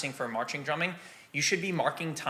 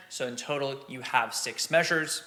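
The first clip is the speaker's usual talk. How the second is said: usual speaking voice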